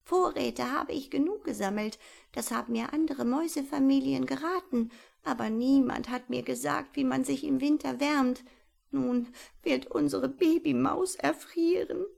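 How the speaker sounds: put-on father mouse despairing over his baby; tearful mouse. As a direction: mourn for the baby. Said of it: "Papa Maus, der schluchzte".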